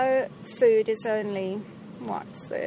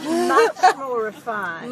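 slow, louder mock-posh English accent; refined English accent; 6 dB louder, much slower than usual. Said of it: "and the English accent".

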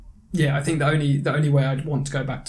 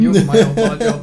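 laughing; 7 semitones higher, 7 dB louder than usual. Real, not acted. Jokes his co-host is old job.

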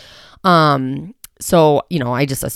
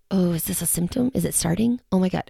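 worried inner voice; 3 semitones higher, 5 dB quieter than usual. Retold or imitated. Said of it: "every morning I'm like".

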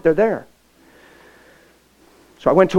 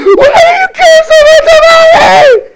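much higher, louder, slow crying shout; sobbing scream; 14 dB louder, slower than usual. Retold or imitated. Girl pressed against the truck screaming at the pastor. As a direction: wail it from the bottom of a broken heart.

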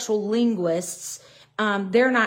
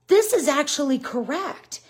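ordinary talk, nothing marked

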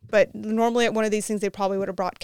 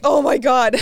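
starstruck oh my god; 3 semitones higher, 6 dB louder than usual. Retold or imitated. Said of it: "And I was like".

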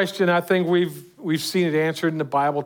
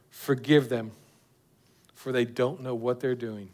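soft, lower prayer of Jesus; tender prayer; 4 semitones lower, 5 dB quieter than usual. Acted. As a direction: plead gently for them. Preacher voices Jesus' prayer.

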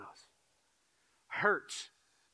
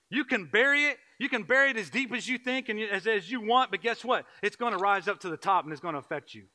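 higher, louder preaching build; rising pulpit fervour; preaching at full force; 8 semitones higher, 8 dB louder than usual. Acted. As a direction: drive it home to the congregation.

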